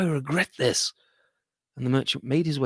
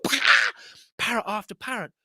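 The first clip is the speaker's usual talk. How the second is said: ordinary delivery, nothing marked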